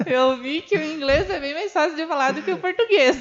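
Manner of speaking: laughing. Real, not acted.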